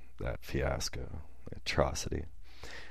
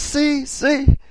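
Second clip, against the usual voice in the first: very high and louder, a shrill gloating "see"; mock triumphant cry; 7 dB louder than usual. Woman gloats at the man before storming out, high mock triumph. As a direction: crow it like proof was just given.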